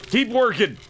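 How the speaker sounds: high playful order; mock bossy shout. Streamer keeping Sven at the bellows, mock bossy shout. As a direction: urge on a worker like a foreman. Ordering game character to work.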